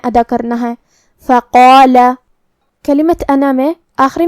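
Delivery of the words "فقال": louder فقال with thick back-of-tongue q and held heavy a; heavy qaf, long alif. Shown as a demonstration. Teacher demonstrates tafkhim, فقال with heavy q. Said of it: "قاف کو اور مد الف کو دونوں کو بھاری آواز میں".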